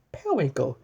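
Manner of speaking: ordinary delivery, nothing marked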